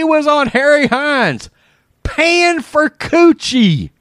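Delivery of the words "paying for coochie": ordinary delivery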